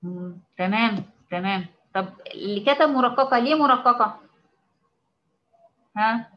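normal talking voice

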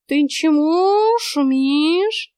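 high, loud, very slow cat drawl; talking cat. A narrator's reading. Cat finds the heroine cursing on the porch, drawling. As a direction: stretch the question out high and long.

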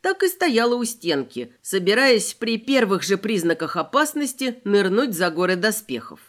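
usual speaking voice

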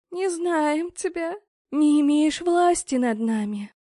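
high, loud, slow demon chorus; many-voiced demons. A narrator's reading. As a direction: argue back as a crowd of spirits. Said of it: "спорили они на разные голоса". (нечисть спорит на разные голоса)

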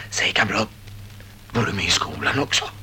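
breathy hushed question; whisper. Acted. Soldier whispering to the stranger in the dark. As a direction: barely breathe it out, wary.

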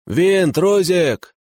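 loud high call across the fog. A narrator's reading. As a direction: call out two names. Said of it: "окликнул справа".

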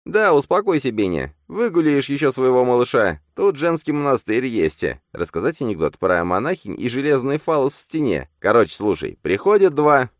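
a shrill inner tormentor; goading double. A narrator's reading. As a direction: needle him cruelly. Phantom mocks.

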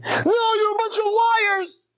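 high, slow crying wail; drunken weeping denial; tearful outburst. Acted. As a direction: sob out the accusation. Drunk refuses owner's sympathy.

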